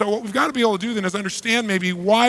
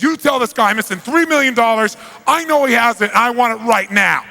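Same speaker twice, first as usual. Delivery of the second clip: loud, higher, demanding the money back; angry mafia boss; 6 semitones higher, 7 dB louder than usual. Retold or imitated. Mafia boss to the interpreter, loud angry demand. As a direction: bark the demand like a furious boss. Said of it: "he turns to this guy and he says".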